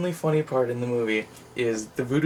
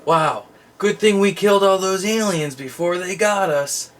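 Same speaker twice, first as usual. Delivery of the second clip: higher, slow, hammed-up self-congratulation; smug soldier; 6 semitones higher, 4 dB louder than usual. Soldiers congratulating themselves after killing unseen aliens, mock smug. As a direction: deliver it like a bad actor patting himself on the back. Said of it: "And they go".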